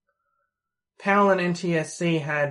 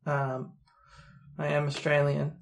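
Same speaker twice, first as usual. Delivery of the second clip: ordinary talk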